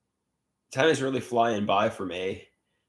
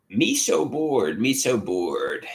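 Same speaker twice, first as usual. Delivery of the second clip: put-on babyish bored; much slower than usual. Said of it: "I'm bored".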